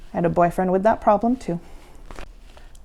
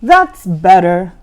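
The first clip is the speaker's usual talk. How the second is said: monster teacher; 8 dB louder than usual. A narrator's reading.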